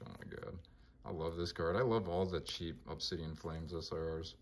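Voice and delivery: ordinary talk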